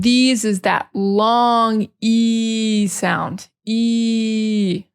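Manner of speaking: these with long stretched E; teacher stretches E in these. Shown as a demonstration. Teacher drawing out long E. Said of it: "stretch that out".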